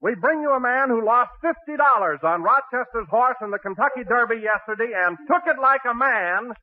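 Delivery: ordinary talk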